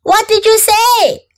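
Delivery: high-pitched questioning call; mom calling. A narrator's reading. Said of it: "asked Mom".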